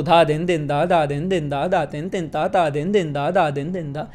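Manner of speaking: even, unaccented धा दिन दिन दा, each syllable the same weight; wrong way to recite bols. Shown as a demonstration. Tabla teacher showing students the wrong recitation, flat and even. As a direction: say धा दिन दिन दा flatly as a monotone run of bols. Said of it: "ये तरीका नहीं है".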